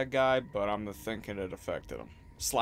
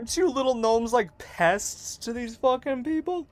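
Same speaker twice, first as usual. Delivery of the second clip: squeaky taunt; 12 semitones higher, 8 dB louder than usual. Acted. High loud taunt at gnome.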